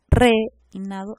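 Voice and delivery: REINADO with the E held, then the rest after; stress on the nucleus. Shown as a demonstration. Teacher shows where the nucleus falls, E of REINADO held. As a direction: lean slowly on the E of REINADO. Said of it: "la E sería el núcleo".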